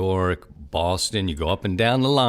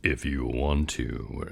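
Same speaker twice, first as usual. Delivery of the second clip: much lower, slow and softer, "want" rising; deeper professor voice; 9 semitones lower, 7 dB quieter, slower than usual. Retold or imitated. Host imitating Syracuse professor's much deeper voice. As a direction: drop into the professor's deep voice. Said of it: "much deeper voice".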